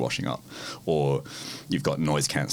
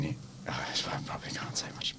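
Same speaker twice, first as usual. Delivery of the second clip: secretive breathy whisper; 11 dB quieter than usual. Real, not acted. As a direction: drop to a whisper, keeping a secret. Confiding something he can't share.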